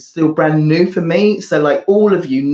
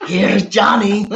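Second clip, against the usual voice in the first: slow, hammed-up movie catchphrase; Jack Nicholson; 3 semitones higher, slower than usual. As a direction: draw it out in a laughing impression. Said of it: "give us your best Jack Nicholson impersonation".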